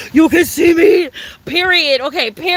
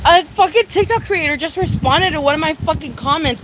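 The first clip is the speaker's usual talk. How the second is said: ordinary talk, nothing marked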